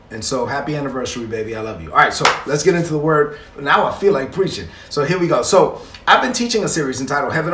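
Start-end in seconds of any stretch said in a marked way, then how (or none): 0.1-1.9 romantic. Real, not acted. Anniversary wish to his wife.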